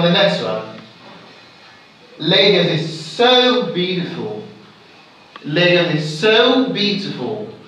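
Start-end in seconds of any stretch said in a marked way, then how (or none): 2.2-4.4 "so" held and emphasized before "beautiful"; stress on so. Shown as a demonstration. Teacher giving an example, emphatic stress on "so". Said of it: "It's so". 5.4-7.5 emphatic, lengthened "so"; stress on so. Shown as a demonstration. Teacher repeating the example, emphatic stress on "so". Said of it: "It's so".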